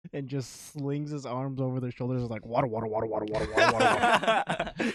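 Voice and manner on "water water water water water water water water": babbled chant building louder; enthusiastic babbling. Retold or imitated. Friend mimicking a stranger at the gas station, enthusiastic babble. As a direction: imitate an excited stranger repeating one word. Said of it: "he was very enthusiastic about it".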